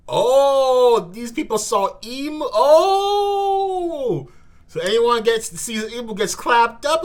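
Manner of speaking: very high, loud astonished yell; stunned high shout; shocked shout. Real, not acted. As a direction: cry out in shock. Shocked at Imu reveal.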